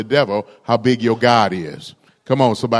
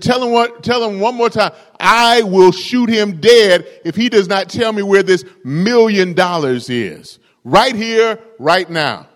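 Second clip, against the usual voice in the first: much higher and louder, shouting the threat; furious ranger; 8 semitones higher, 5 dB louder than usual. Acted. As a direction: scream the ultimatum at him.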